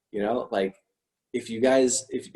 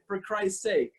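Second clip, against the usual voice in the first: ordinary delivery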